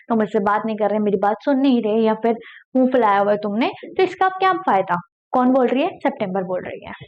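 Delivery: ordinary delivery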